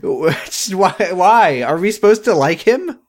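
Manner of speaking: high, rising disbelief; exasperated. Real, not acted. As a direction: pitch up in baffled exasperation. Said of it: "exasperated rant".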